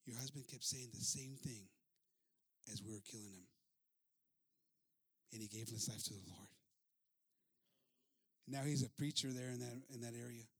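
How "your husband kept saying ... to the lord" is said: low, soft, sobered telling; subdued. Acted. Chief recalling the dying husband's words, subdued. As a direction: sink lower and softer as it lands.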